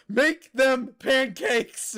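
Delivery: slow, loud, high commanding chant; hammed-up godly order; mock god command. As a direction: command it slowly like a deity.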